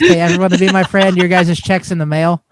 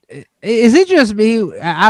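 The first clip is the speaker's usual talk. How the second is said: ordinary delivery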